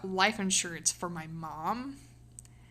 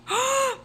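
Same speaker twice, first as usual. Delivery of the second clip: sharp gasp. Real, not acted. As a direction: gasp as the idea hits. Sudden realisation mid-stream.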